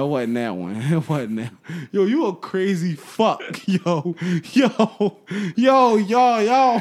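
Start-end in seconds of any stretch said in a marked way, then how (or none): 1.9-6.8 high, loud laughing exclamations; cracking up mid-sentence. Real, not acted. Cracking up while telling the story.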